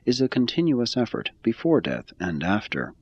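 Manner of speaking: usual speaking voice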